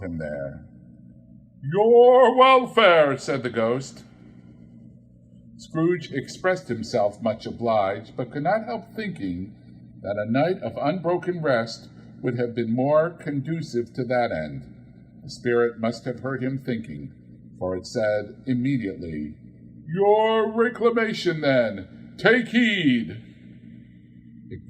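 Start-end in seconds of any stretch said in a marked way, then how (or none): 1.6-3.2 solemn ghost. Acted. 19.9-23.2 ghost warning. Acted. Ghost warns Scrooge.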